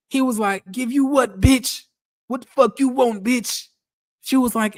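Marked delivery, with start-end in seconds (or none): 0.7-3.7 rough, higher-pitched mock husband, a bit louder; Charles voice. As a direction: snap back at her like the rude husband from the show. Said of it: "Y'all want me to do the Charles voice".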